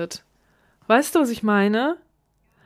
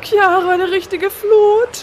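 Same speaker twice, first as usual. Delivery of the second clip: much higher, much louder, slow wail; acted crying fear; 10 semitones higher, 8 dB louder, slower than usual. Watching the flood of animals, slow crying wail. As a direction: wail it as if in tears.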